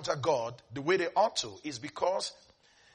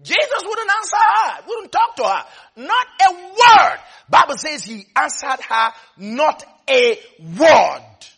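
loud, high, drawn-out declaration; emphatic preaching shout; 6 semitones higher, 14 dB louder, slower than usual. Real, not acted. Preacher stressing Jesus answered her not a word, shouted. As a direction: hammer every word at full volume.

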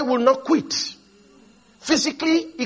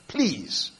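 soft, low pleas with pauses between; hushed, beaten-down retold plea; begging; 8 semitones lower, 10 dB quieter, much slower than usual. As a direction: quietly imitate someone begging. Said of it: "He had to come begging this boy".

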